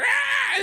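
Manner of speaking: short, very high loud shriek; goblin scream. Acted. Goblin in the kitchen as dishes crash, high scream. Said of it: "He's screaming in the kitchen".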